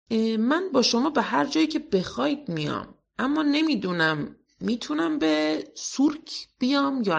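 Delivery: put-on a playful girl puzzling it out; cheerful girl asking if the circus is dangerous; Pippi voice.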